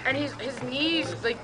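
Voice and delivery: ordinary delivery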